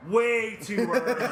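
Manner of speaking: laughing slow aside. Retold or imitated. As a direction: grin it out at the camera. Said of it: "and I go".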